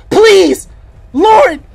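loud, high cry for help; panicked shouted prayer; desperate plea. Real, not acted. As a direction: beg at the top of your voice. Praying aloud while chased.

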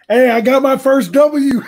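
cheering his first win; excited shout. Real, not acted. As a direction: shout it out, you finally won. Celebrates his first online win.

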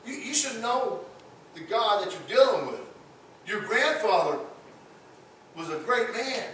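lightly voiced warning to Belshazzar; stern Daniel rebuke. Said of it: "He says".

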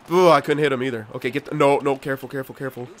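ordinary talk

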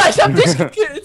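high, loud laughing exclamation. Real, not acted. Player laughing at the friend he just killed, high loud laughter. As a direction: laugh it out at the victim.

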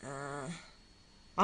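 ordinary talk

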